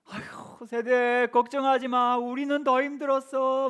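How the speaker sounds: lightly mocking the elder ladies, high and slow; unhelpful elder deaconesses. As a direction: mimic a dismissive elder, stretching 더. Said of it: "막 그러셨어요". (무시하듯 흉내 낸 권사님 말투)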